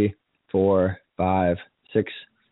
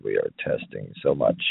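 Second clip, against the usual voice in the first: low mic-check voice; deep testing voice; 11 semitones lower than usual. Pretend. Host checking the microphone, in a deep low voice.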